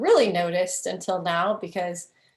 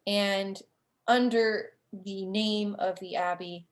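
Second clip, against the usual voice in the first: usual speaking voice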